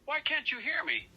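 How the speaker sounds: high-pitched, out-of-patience protest; exasperated outburst. Acted. Marshall snaps at the person who can't hear his number, exasperated. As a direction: let the voice leap up in exasperation. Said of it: "He has lost his patience".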